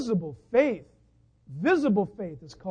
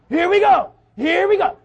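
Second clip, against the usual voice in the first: high, loud imitation of a thrilled child; mock fired-up kid; 9 semitones higher, 16 dB louder than usual. Preacher mimicking Christians thrilled just to taxi, mock excitement. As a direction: shout it like an overexcited kid.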